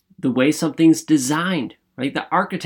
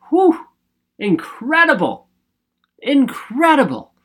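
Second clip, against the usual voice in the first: a much higher whew, then incredible said in awe; amazed whew; 9 semitones higher than usual. Real, not acted. Host marvels at how insects are designed, in awe.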